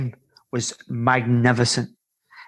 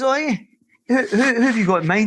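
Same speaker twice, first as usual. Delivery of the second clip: mock surprise; 9 semitones higher than usual. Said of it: "I says".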